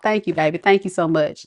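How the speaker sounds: normal talking voice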